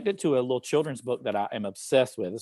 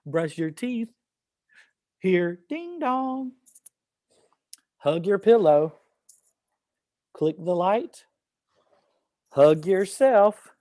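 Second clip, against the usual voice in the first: higher, slow, drawn-out action-poem reading; poem read to children; 6 semitones higher, much slower than usual. Acted. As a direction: lead children through each action, slowly.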